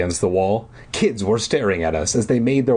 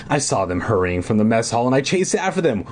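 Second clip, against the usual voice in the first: ordinary delivery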